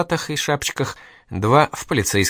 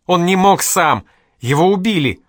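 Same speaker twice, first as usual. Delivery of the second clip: breaking into a scream; 8 semitones higher, 6 dB louder than usual. Acted. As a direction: scream it out in despair. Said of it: "опять сорвалась на крик".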